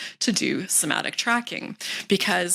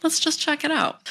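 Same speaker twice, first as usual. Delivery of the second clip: much higher, bright and playful; light curiosity; 9 semitones higher than usual. Shown as a demonstration. Coach models a light, curious reply to symptoms. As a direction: invite a look with easy interest. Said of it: "Just like".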